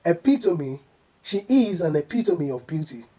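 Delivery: epitome as e-PI-to-mee; correct epitome. Shown as a demonstration. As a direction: sound the final "mee" in epitome, falling. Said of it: "kindly say".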